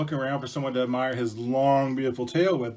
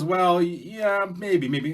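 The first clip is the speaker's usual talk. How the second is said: slow, stammering, higher-pitched fox; hesitant fox; 7 semitones higher, 5 dB louder, much slower than usual. Acted. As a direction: stall doubtfully before giving in. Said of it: "And Fox goes".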